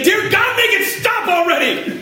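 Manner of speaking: high, wailing cry to God; hammed-up overwhelmed prayer; desperate mock plea. Acts out desperate prayer at printer.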